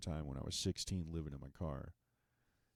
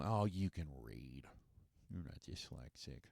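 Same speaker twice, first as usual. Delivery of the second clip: patronizing; 6 semitones lower, 8 dB quieter than usual. Retold or imitated. Mocking people, patronizing.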